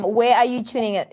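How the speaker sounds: normal talking voice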